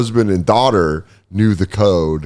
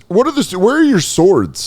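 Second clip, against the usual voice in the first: put-on curious kid; 10 semitones higher than usual. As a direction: play a wide-eyed boy on a tour. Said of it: "I'd be like this".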